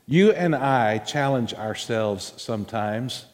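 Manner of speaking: ordinary talk, nothing marked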